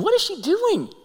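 high amused what-is-she-doing; puzzled inner thought. Retold or imitated. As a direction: wonder it to yourself, high and puzzled. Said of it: "I'm thinking".

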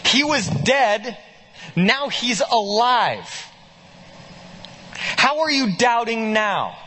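emphatic pulpit rhetoric; rising preaching. Acted. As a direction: preach it up with mounting disbelief. Preacher builds to full force.

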